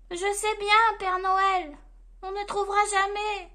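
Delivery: high-voiced elf, giving up; exasperated elf. A narrator's reading. Exasperated elf replies again.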